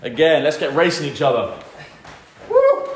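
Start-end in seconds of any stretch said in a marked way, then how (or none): 2.5-2.9 high, loud cheering woo; excited whoop. Real, not acted. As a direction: whoop to fire up the class. Cheering during racing jumping jacks.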